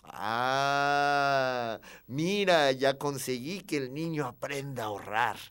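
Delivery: long drawn-out, loud "ah", then satisfied remark; pleased dad showing off his son; proud father. Acted. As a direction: announce it with fatherly pride. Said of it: "le dijo con mucho orgullo".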